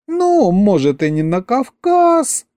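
high, dreamy stretched-out vowels; languid drawl. A narrator's reading. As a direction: draw it out like a swooning girl. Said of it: "томно протянула Маша".

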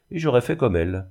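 usual speaking voice

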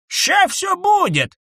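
slow, loud eager promise; eager shout. A narrator's reading. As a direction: shout it out happily. (радостный выкрик)

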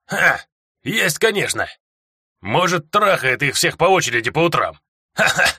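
high scoffing dwarf with a laugh; mocking dwarf laugh. A narrator's reading. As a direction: sneer through a chuckle.

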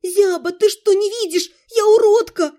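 rising to a loud high wail; panicked wail. A narrator's reading. Heroine panics over the mark: rising loud panicked wail. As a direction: panic building to a cry.

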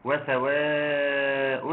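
وسواء with the long vowel held for four counts; وسواء drawn out, the madd held long; four-beat madd. Shown as a demonstration. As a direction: stretch the vowel in وسواء slowly. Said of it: "مد متصل واجب يمد بمقدار أربع حركات".

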